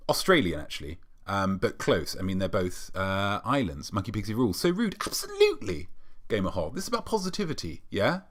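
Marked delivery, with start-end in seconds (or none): 4.6-5.9 high squeak on Absolutely; hammed-up huffy squeal; mock offended squeak. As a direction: pretend to be scandalised, very high. Mock offence at rude comment.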